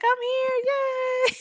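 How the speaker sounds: squeaky high, sing-song yay; mock cheerful. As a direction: play the carefree mom arriving. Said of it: "She's like".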